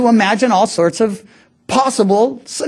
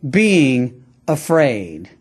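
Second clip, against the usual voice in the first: slow reassurance; 5 semitones lower, much slower than usual. Retold or imitated. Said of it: "he says".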